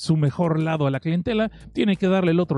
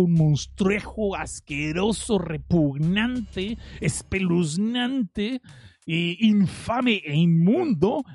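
slow, dragged-out words of horror; drawn-out disgust; much slower than usual. Pretend. Describing the monster to the audience, slow and disgusted. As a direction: savour each gross word slowly. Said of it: "dramatic creepy description".

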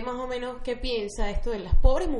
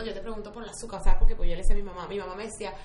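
normal talking voice

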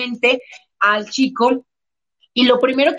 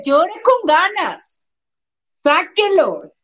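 encouraging demo; 5 semitones higher, slower than usual. Shown as a demonstration. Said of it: "dígale".

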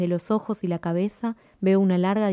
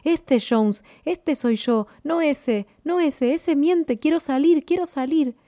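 inner voice screaming; 8 semitones higher, slower than usual. A narrator's reading. The trapped voice inside Jones screams to escape, high desperate cry. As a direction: scream to be let out, pitched high. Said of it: "la voz profunda y oculta en mí grita".

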